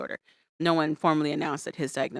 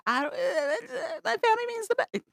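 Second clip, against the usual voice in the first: mocking helpless whine. Mark making excuses to upset Mina, mock whimpering. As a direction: imitate him floundering in a high whine. Mocks Mark failing to answer.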